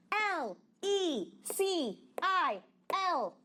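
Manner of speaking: high, clear spelled letters; teacher spelling out a name. Shown as a demonstration. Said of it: "Vamos lá".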